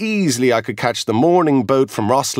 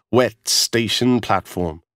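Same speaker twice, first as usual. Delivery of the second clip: ordinary delivery, nothing marked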